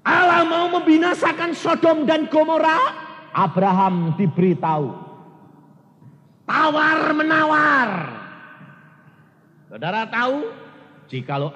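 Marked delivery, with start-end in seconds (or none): 0.1-7.9 loud, high preacher's proclamation; preaching at full force. Acted. Preacher proclaiming the story of Sodom, loud and high. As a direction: call it out to the congregation.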